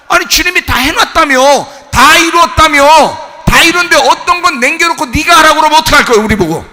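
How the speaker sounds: loud, high, protesting sermon; impassioned preaching. Real, not acted. Preacher protesting that all is finished, impassioned. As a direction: hurl the challenge at full force.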